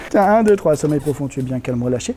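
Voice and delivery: soft, low hypnotic suggestion; hypnotist voice. Acted. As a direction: lull them into deep trance. Hypnotist gives new order.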